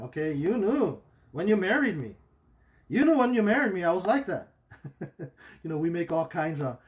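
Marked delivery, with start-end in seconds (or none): 0.3-2.1 lightly voiced defensive spouse, a bit higher; mock excuse. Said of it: "that's how people see it". 2.9-4.5 higher and louder, mock indignant spouse; mock indignant excuse. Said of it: "that's how people see it".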